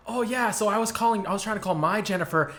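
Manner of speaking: high-pitched, nervous rambling; nervous retelling. Retold or imitated. Man who hit the car explaining to the mom, nervous. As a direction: imitate a flustered man over-explaining. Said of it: "he's like, really nervous".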